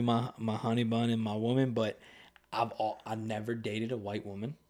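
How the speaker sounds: ordinary talk, nothing marked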